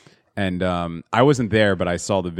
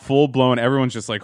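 ordinary talk, nothing marked

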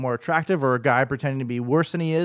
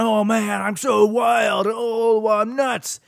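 hammed-up wild reality-show contestant, higher, with a mock shout; mock crazy contestant; 7 semitones higher, much slower than usual. Mocking reality contestants, a hammed-up crazy show-off voice. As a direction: play the over-the-top contestant bragging about being wild. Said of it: "really crazy personalities".

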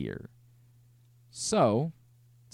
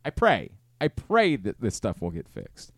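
ordinary delivery

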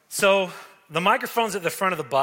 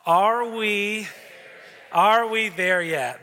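drawn-out whiny are we there yet; whiny kid question; 3 semitones higher, 4 dB louder, much slower than usual. Retold or imitated. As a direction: stretch it out like a bored kid. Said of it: "I'm gonna say the question, and if you know it, say it with me".

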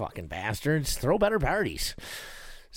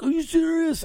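high, louder disbelieving cry; shocked brother; 5 dB louder than usual. Retold or imitated. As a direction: react in alarm to bad news. Brother shocked at his injury.

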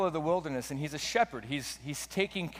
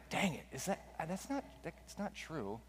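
much softer, hesitant muttered doubt; retold flustered self-talk under the breath; unsure inner thought; 3 semitones higher, 10 dB quieter than usual. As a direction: stumble quietly over it, as if thinking to yourself. Recalling own flustered inner thought.

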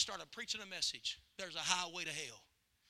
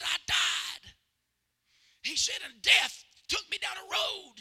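louder, higher, slow urgent cry; 10 semitones higher, 8 dB louder, slower than usual. Retold or imitated. Grandfather telling the preacher of his death, loud. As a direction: cry it out like he's reliving it.

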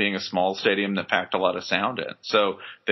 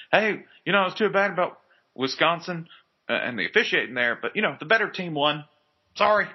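mock apologetic coach; 8 semitones higher than usual.

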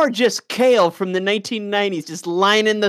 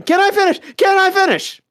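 much higher, louder cartoon voice; repeated high squeak; South Park bit; 14 semitones higher, 4 dB louder than usual. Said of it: "that South Park thing".

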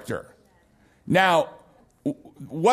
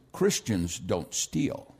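much lower, softer and slow; solemn little boy; 12 semitones lower, 9 dB quieter, slower than usual. Retold or imitated. Son telling the store lady, quiet and slow. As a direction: deliver it slow and earnest like a small boy. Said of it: "And he goes".

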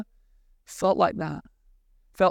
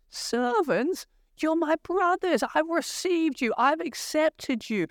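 higher, incredulous pleading brother; dismayed Joseph; 6 semitones higher than usual. Retold or imitated. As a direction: protest like a brother who can't believe it. Preacher voices Joseph's dismay.

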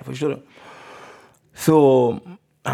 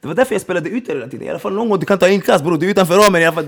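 normal talking voice

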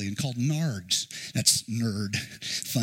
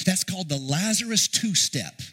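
ordinary delivery

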